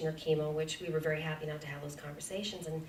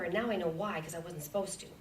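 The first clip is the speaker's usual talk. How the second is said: ordinary talk, nothing marked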